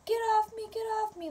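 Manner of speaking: high-pitched mock scream; imitated screaming. Retelling the attacked sister's cries from across the street, imitated scream. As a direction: re-enact her screaming without full volume. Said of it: "like, screaming, like, bloody murder".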